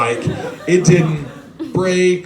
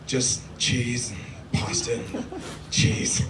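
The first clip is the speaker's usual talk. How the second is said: food whisper; 4 semitones lower, 7 dB quieter, much slower than usual. Real, not acted. Dreamy whisper about food.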